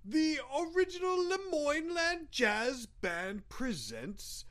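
grand stage introduction; showman announcer. Acted. Introducing the band's act.

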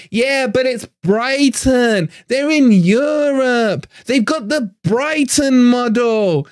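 high, slow, sing-song mimicry of smug fans; a sneering impression of complacent supporters; mocking happy clappers. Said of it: "happy clappers coming out now saying".

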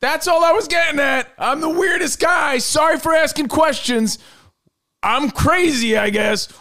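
exasperated rant. Real, not acted. Indignant rant at co-hosts.

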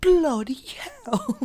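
high, loud mock British outburst; hammed-up British exclamation; bad British accent. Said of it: "trying to do a British accent".

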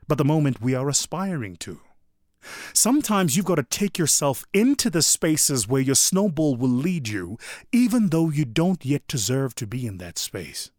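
usual speaking voice

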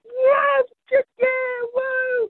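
high, cheering celebration; a retold victory yell, high and loud; jubilant whoop. Celebrating alone in dressing room.

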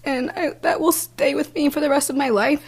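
high, tearful voice; fighting tears through a painful memory; real emotion. Real, not acted. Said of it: "gonna get a little emotional".

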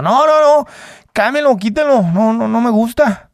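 very high, loud mock boxer's refusal; imitating Julio angry. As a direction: bark it out as the champ. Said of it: "Habla muy bronco, muy exagerado".

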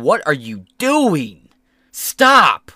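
rising to a loud yell. Retold or imitated. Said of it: "he was on T V going".

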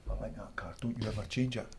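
soft, low-key warning; quiet. Retold or imitated. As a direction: say it softly, half joking. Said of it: "he would have a look and just say".

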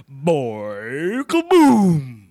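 big shouted kaboom; loud cartoon boom. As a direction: blast it out like an explosion. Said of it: "now I'll do a noise".

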